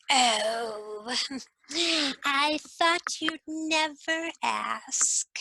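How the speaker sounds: put-on a strange old hag's voice, slow with a cackle and whispers; Dame Ragnell. Dame Ragnell slyly naming her reward before the court. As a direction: savour every word like a sly crone.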